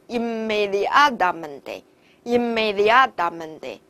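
teacher's slow model of inmediatamente, both stresses drawn out; double-stressed pronunciation. Shown as a demonstration. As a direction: slowly pronounce inmediatamente, stressing the DIA and MEN. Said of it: "所以这个词读起来就是".

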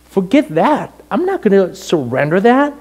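high, indignant refusal; bitter refusal. Retold or imitated. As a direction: dig in, high and resentful. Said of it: "I was just bitter".

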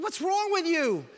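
high, drawn-out indignant question; exasperated outburst. Retold or imitated. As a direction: burst out in disbelief at the waiter. Exasperated reaction to unhelpful waiter.

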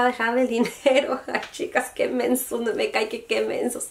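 real laughter breaking through the words; laughing at herself. Real, not acted. Telling her viewers about rushing off with the money, laughing. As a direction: laugh it off, rueful at how dumb they were. Laughing at their own foolishness.

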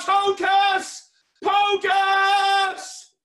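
very loud, high, drawn-out chant; magic-word shout. Shown as a demonstration. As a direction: bellow the magic words, stretching each one out. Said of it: "Everybody shout".

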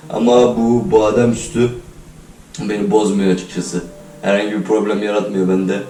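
usual speaking voice

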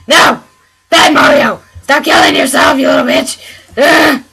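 furious shout at a game character who keeps dying; angry yelling at game. Real, not acted. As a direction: scold Mario like a bad pet. Yelling at Mario on screen.

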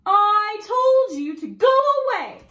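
high, loud and fierce; fierce call. Acted. Fierce little woman yelling at pirate through door. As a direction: snap it at the door. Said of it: "called the fierce little woman".

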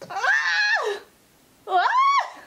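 very high-pitched overwhelmed shriek; real excited scream. Real, not acted. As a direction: squeal as if about to burst into tears. Freaking out as her video starts.

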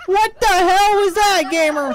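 excited. Real, not acted. Reacting to the big play.

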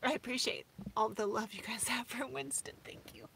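soft, breaking into whispered thanks; crying. Real, not acted. Thanking viewers for loving the dog, choked up softly. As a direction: let tears swallow the voice. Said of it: "'cause I'm crying".

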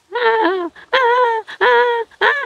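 short repeated wails like a crying infant; demonstrated newborn wail, one cry after another; imitated baby crying. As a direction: mimic a baby's cry in little bursts. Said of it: "Khóc vậy nè".

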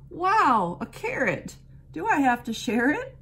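high, loud delighted wow; delighted surprise. A narrator's reading. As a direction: gasp with joy at the treat. Doe delights at carrot.

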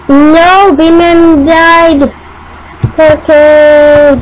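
loud upset cry of no at a teammate's death; dismayed shout. Real, not acted. Gamer yelling to viewers as teammate dies, loud dismay. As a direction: shout it out, heartbroken over the game.

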